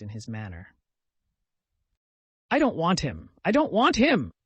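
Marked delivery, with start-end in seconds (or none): 2.5-4.3 a cry that climbs much higher and louder into a break; breaking, mournful refusal from the sickbed. Acted. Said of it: "cried Ilusha, with a mournful break in his voice".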